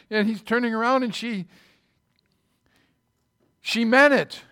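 ordinary talk, nothing marked

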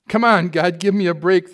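loud, high mock pleading; pleaded. Atheist hanging in midair pleading with God, loud and high. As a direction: beg God for a break, loud. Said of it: "the man pleaded".

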